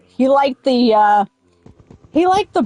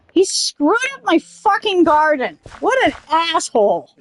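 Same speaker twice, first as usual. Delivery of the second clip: ordinary talk, nothing marked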